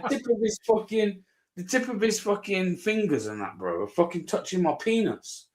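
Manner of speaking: ordinary talk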